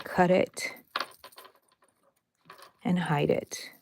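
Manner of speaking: ordinary talk, nothing marked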